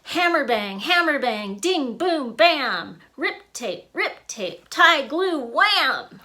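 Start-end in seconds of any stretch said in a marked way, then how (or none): none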